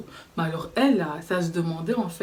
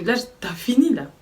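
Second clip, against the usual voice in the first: sharp challenge; 5 semitones higher, 5 dB louder than usual. Retold or imitated. Confronting the girl, retold.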